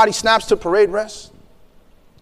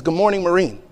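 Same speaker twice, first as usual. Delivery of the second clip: low, soft sergeant's salute; retold quiet acknowledgement to a new Marine; solemn greeting; 4 semitones lower, 7 dB quieter than usual. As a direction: say it low and restrained.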